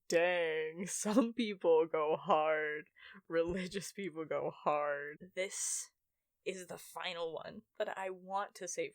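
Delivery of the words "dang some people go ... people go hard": lower, slow, lightly voiced impressed kid; a hint of awed amazement; imitating awed child self. Child thinking about super Jehovah's Witnesses, lightly awed. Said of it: "and I was like".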